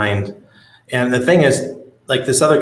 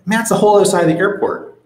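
exasperated outburst; 10 semitones higher, 4 dB louder, faster than usual. Retold or imitated. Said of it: "I was like".

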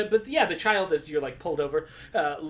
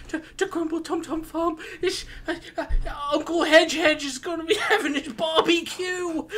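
put-on high, nervous child voice with giggles; a small kid trying to explain; worried child; 9 semitones higher than usual. Child explains the barbecue to Lorne, high and worried. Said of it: "He's like".

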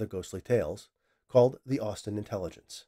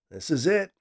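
high and louder, eager but nervous; excitement and trepidation; 7 semitones higher, 5 dB louder than usual. Acted. Scientist about to run his experiment, excited and nervous. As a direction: breathe out the big moment. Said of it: "stated with excitement and trepidation".

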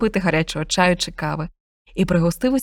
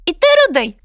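much higher, louder bird voice; chirpy bullfinch; 12 semitones higher, 5 dB louder than usual. Acted. Bullfinch urges cat and dog to follow, high bird voice. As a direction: chirp it urgently. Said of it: "мовив птах до пса".

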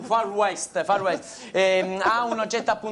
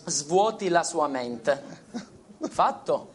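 soft, slow hypnotist voice; voce da ipnotizzatore; 5 semitones lower, 3 dB quieter than usual. Acted. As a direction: lull her into a trance, softly. Ipnotizza la signorina. (voce ipnotica, lenta e sommessa)

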